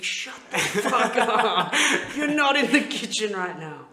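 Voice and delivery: very loud, high, slow, cracking up mid-yell; family ganging up on the sister, laughing mock shout. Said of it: "we're just like".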